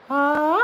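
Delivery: high, loud sung wail; classic riff. Pretend. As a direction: belt the classic high note. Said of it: "I also love the classic".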